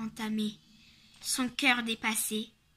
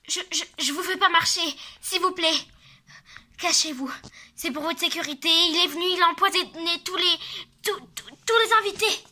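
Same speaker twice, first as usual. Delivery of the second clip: high, loud frantic plea; panicked pleading; 5 semitones higher, 6 dB louder, faster than usual. Acted. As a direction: beg them frantically.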